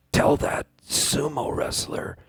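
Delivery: slow, breathy deathbed whisper; dying whisper. Retold or imitated. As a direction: whisper it slowly into the son's ear. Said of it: "put my ears right on his lips".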